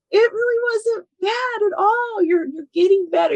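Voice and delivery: high, put-on soothing husband voice; consoling husband. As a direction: mimic a husband gently talking his wife up. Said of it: "he would try".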